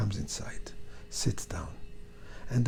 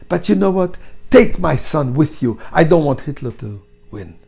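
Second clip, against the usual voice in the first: anguished outburst; 6 semitones higher, 13 dB louder than usual. A narrator's reading. Daughter gives up her son.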